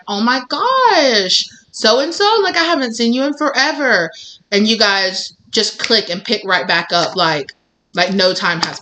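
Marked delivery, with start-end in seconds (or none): 0.1-4.1 acted happy squeal of recognition, words stretched; delighted reunion. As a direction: gush as if running into a long-lost friend. Acted reunion with an old friend.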